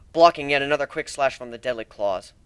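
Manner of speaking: ordinary delivery, nothing marked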